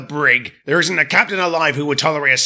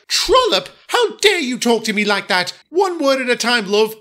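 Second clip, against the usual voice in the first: high, disdainful, sniffy; haughty diesel lady; 8 semitones higher than usual. Acted. Daisy complains about the smelly shed, high and sniffy. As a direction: turn up your nose at the shed.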